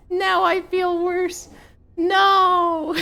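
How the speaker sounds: high, loud mock dismay; mock outrage. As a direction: squeal it in fake horror.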